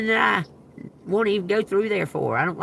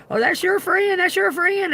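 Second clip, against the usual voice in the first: high teasing sing-song voice, much higher; mocking the onlookers; 11 semitones higher than usual. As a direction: mimic the crowd teasing her. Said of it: "they're all calling her".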